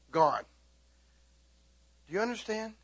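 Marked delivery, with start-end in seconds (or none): none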